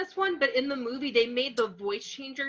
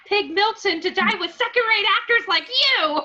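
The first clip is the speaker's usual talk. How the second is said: much higher, louder hammed-up rage; dramatic outburst; 9 semitones higher, 6 dB louder than usual. Acting out Angelina's last line before she's stabbed, hammed-up fury. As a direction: spit it out like a soap-opera villain. Acts out dying character's line.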